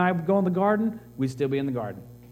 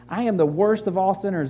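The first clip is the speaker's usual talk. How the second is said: lightly voiced Paul, loud, stress on worst; Paul's confession; 5 semitones higher, 4 dB louder than usual. Pastor quoting Paul's words about himself, lightly voiced. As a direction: proclaim it as Paul owning his sin. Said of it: "is what he said about himself".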